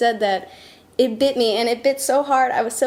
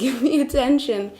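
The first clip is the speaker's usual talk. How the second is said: ordinary talk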